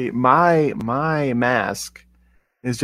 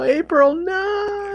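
high, anguished "April, no"; a high, wailing protest; dismayed wail; much slower than usual. Real, not acted. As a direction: cry out in mock horror at her mistake. Reacting to April's blunder.

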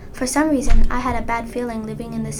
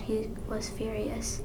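ordinary delivery, nothing marked

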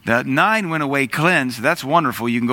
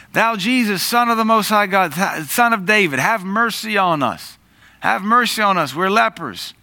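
lepers calling out; 7 semitones higher than usual. Acted. Lepers crying out from afar.

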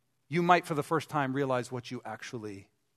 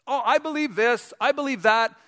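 mock believer; 6 semitones higher, 4 dB louder than usual. Mocking shallow believers.

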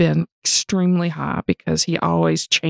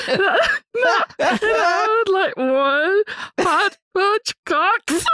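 high, breathless laughing fit; laughing hard; slower than usual. Real, not acted. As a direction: crack up, can barely speak. Laughing fit telling story.

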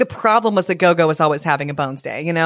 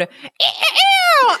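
high-pitched imitated cock-a-doodle crow; rooster crow. A narrator's reading. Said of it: "flapped his wings and crowed".

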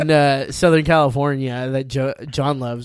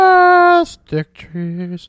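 drawn-out title; 5 semitones higher, 9 dB louder, much slower than usual. Pretend. Drawing out the song title.

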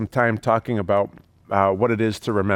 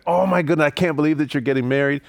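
excited squeal; 6 semitones higher, faster than usual. Retold or imitated. Guest hearing of the wedding, gushing with excitement. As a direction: gush at the couple as an overjoyed guest. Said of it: "Everybody was so excited".

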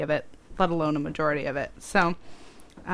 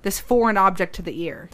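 usual speaking voice

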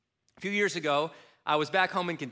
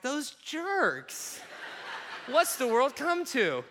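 high, laughing fake indignation; trying to act normal in front of the stranger; mock outrage; 9 semitones higher, slower than usual. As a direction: ham up the disapproval to cover. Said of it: "I had to play this off".

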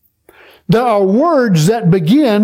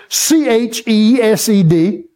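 normal talking voice

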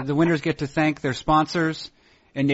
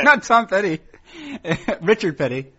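laughing; 5 semitones higher than usual. Real, not acted. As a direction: repeat it between laughs. Still laughing at the joke.

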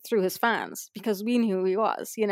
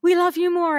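high, louder affectionate one-upping; playful insistence; 9 semitones higher, 6 dB louder than usual. Retold or imitated. Fans trying to outdo his love, playful insistence. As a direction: imitate fans eagerly outdoing him.

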